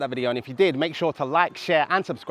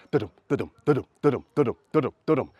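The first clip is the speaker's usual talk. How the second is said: quicker da-dum beat; faster gallop rhythm; much faster than usual. Shown as a demonstration. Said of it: "Or it might be faster".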